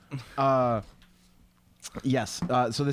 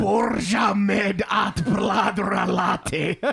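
slow, high, hammed-up German shout; mock German accent; 6 semitones higher, 3 dB louder, much slower than usual. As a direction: declaim Swedish like a cartoon German. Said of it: "Now you're doing German".

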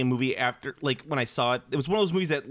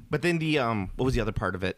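ordinary talk, nothing marked

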